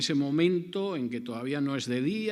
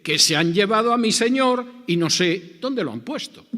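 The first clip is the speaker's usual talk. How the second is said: higher and much louder, pleading; anguished cry; 8 semitones higher, 10 dB louder than usual. Acted. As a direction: cry out the loss of her Lord. Mary's lament acted out.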